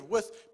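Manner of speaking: "with" lifted and pressed; stressed with. Shown as a demonstration. Preacher stressing one word of Jesus to the church, emphatic. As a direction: lean on "with" as the key word. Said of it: "Emphasis on the word".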